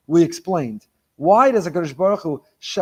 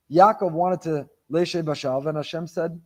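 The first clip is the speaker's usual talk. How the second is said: normal talking voice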